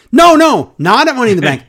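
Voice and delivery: high, loud, hammed-up "no, no"; mock loud correction. MVP cutting off Lashley, mock loud and high. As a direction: overact the frantic interruption.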